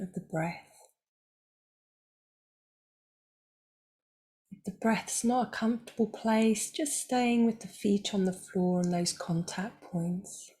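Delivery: calm, unhurried voice with long pauses; guided meditation. Acted. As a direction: guide them gently into stillness, leaving space between phrases.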